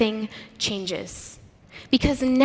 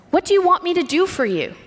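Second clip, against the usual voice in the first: higher, fast, impatient question; impatient demand; 7 semitones higher, faster than usual. Shown as a demonstration. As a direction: fire it off like a busy man interrupted. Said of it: "read these stories with a gentleness".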